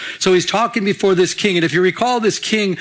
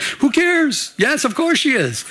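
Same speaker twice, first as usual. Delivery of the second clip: excited retort; 8 semitones higher, slower than usual. Retold or imitated. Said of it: "And I'm like".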